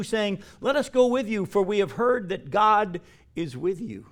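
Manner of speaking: solemn Bible reading that swells into a proclamation; scripture reading. Acted. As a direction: proclaim the prophecy with rising force. Scripture reading, rising to full force.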